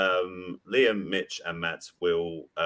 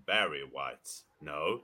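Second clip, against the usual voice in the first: usual speaking voice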